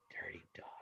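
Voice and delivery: hushed teasing jab; dad joking about the kids; whispered joke. Retold or imitated. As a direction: whisper Dirty dogs as a playful aside. Jokey aside about ungrateful kids.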